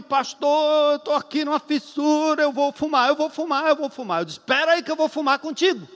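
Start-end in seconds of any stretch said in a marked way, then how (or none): 0.0-4.2 high, urgent pleading on the phone; mock a desperate caller, pitched up; craving addict. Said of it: "O cara deu uma fissura". 4.4-5.9 high, hurried call to hold on; urgent reply. Retold or imitated. Pastor urgently answers craving caller.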